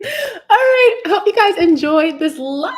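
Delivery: high, loud laughing exclamation; excited laughing sign-off; laughing burst. Real, not acted.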